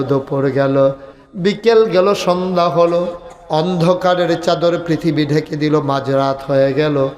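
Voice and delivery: ordinary talk, nothing marked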